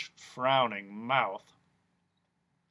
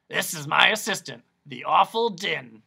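louder, grand introduction; louder pompous doctor; Doctor Discord; 3 semitones higher, 7 dB louder than usual. Acted. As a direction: present him like a showman.

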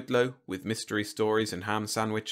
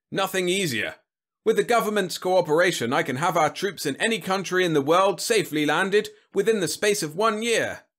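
loud, higher-pitched confident pitch; 9 semitones higher, 5 dB louder than usual. A narrator's reading. General promising Congress troops anywhere, confident and loud. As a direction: sell it big, with total assurance. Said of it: "he had said confidently".

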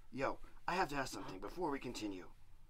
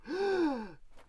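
high startled gasp; sharp gasp; 11 semitones higher, 4 dB louder than usual. Acted. Devil seeing the cat, high gasp. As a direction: gasp in alarm.

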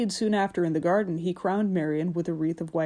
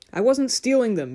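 loud, high indignant protest; hurt and angry denial; 6 semitones higher, 5 dB louder than usual. A narrator's reading. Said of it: "Marian answered angrily".

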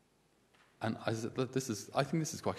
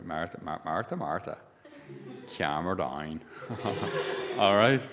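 put-on slight Donegal accent; 3 semitones lower than usual. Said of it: "Jesus saying this in a slightly Donegal accent".

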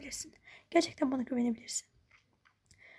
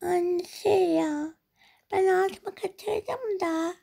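put-on slow, higher child voice; sheepish little girl; 5 semitones higher, much slower than usual. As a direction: confess shyly. Girl confessing wetting bed.

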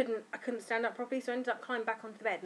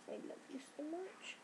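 tender whisper; 6 semitones higher, 15 dB quieter than usual. Real, not acted. Speaking softly to the baby.

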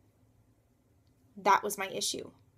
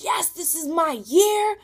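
higher, louder and slow, triumphant; hopeful cheer; 8 semitones higher, 7 dB louder, slower than usual. Retold or imitated. Retelling her new-year hope, high loud cheer. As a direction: proclaim it like a victory. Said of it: "I was like".

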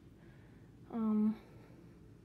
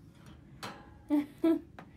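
breathy laugh; 5 semitones higher, 4 dB louder than usual. Real, not acted. Laugh after bird hisses.

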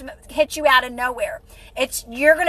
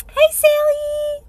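high, slow drawn-out hello; sing-song greeting; 12 semitones higher, much slower than usual. Real, not acted. Greeting a live viewer.

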